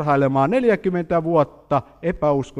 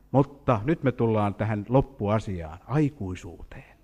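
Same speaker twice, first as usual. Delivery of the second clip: ordinary talk, nothing marked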